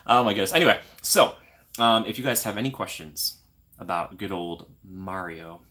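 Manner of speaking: ordinary talk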